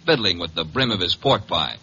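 wry first-person narration over music; story narration. Acted. Archie narrating the case to the listeners, wry storyteller voice. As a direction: tell it dry, like a detective recounting the case.

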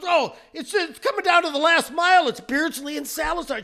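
high-pitched retold commentary; excited race update. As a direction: relay the race breathlessly as it happens. Retold live call of last mile.

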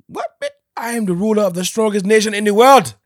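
hammed-up furious ruler, loud and high; going off. Queen answering a challenge to her rule, mock fury. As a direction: roar it like an offended sovereign. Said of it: "Goes off".

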